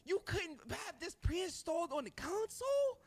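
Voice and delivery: rising to a high squeal of outrage; incredulous rant. Real, not acted. Streamer ranting at Nintendo, voice climbing high in outrage.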